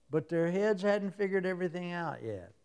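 ordinary delivery

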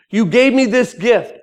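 high, loud objection to the Lord; earnest protest to God. Acted. Answers back to God in dialogue.

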